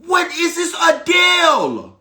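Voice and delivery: dad scoffing at the fake name; mock angry shout; outraged father. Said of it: "my dad was like".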